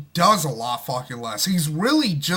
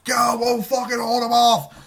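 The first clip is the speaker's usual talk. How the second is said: mock battlefield shout, slightly higher; pretend soldier yelling; 5 semitones higher than usual. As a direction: bark orders at fleeing civilians. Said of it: "he's, like, yelling at fucking people".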